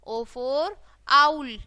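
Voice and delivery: usual speaking voice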